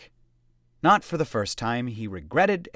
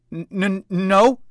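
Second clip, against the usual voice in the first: stammering fright; 9 semitones higher, 9 dB louder, slower than usual. A narrator's reading. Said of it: "the trainee began".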